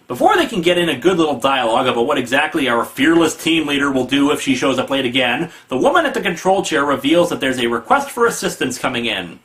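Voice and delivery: ordinary delivery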